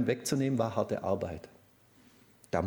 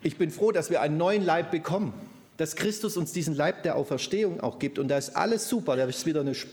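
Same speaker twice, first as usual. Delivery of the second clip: louder, higher, eager preaching about the new body; joyful preaching; 5 semitones higher, 3 dB louder than usual. Real, not acted. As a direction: preach with rising joy and energy.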